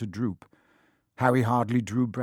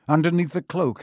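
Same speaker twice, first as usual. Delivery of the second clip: ordinary delivery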